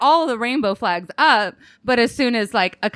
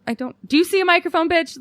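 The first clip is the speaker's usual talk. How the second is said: high, fast mock sass; sassy comeback; 6 semitones higher than usual. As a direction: toss it off with attitude. Sassy reply to drunk customer.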